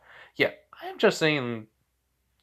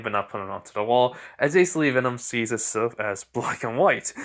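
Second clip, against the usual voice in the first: plain reading voice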